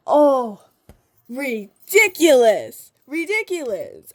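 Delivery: loud, slow, drawn-out ridiculous; dramatic disbelief; exasperated. Real, not acted. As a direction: scoff at the absurdity. Exasperated outburst.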